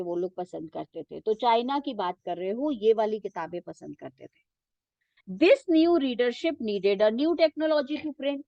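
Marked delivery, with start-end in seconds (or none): 5.4-8.4 reading a textbook sentence very slowly for students to follow; teacher reading the book aloud, slow and measured. Shown as a demonstration. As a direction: dictate it slowly, word by word.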